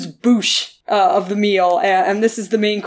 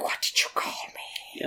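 Gollum whisper; 15 dB quieter than usual. Retold or imitated. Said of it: "and Gollum's like".

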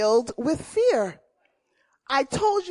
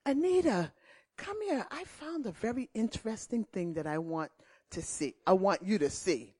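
a hint of a coaxing big brother, hushed; sly big brother; 4 semitones lower, 10 dB quieter, faster than usual. Brother luring his little sister to see. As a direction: lure her in gently, half whispered. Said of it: "And he'll say".

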